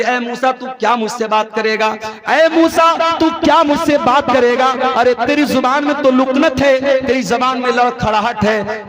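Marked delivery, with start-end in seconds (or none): 2.3-8.9 higher, scornful challenge to Moses; mocking Pharaoh. Pharaoh sneering at Moses' stammering tongue, mocking. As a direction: taunt him as an arrogant king. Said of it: "मूसा का मजाक उड़ाया".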